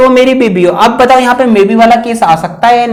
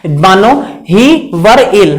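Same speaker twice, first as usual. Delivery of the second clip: slow dictation; 3 semitones lower, much slower than usual. Shown as a demonstration. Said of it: "teacher dictates the example slowly".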